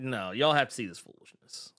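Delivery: normal talking voice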